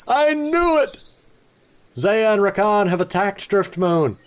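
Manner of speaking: high excited cry, then slow declaration; triumphant outburst. Acted. Hero exclaiming about the attack, high and excited. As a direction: shout it like you saw it coming.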